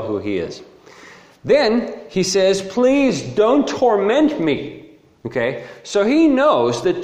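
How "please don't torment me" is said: high, drawn-out mock begging; acted pleading. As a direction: plead slowly in a raised pitch. Acting out the demon begging Jesus.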